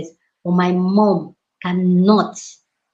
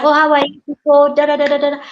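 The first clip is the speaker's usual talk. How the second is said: higher and louder, all sweetness; sweet mother-in-law greeting; 6 semitones higher, 4 dB louder than usual. Retold or imitated. Mother-in-law sweetly greets her son, higher and louder. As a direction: put on a sugary greeting for the son.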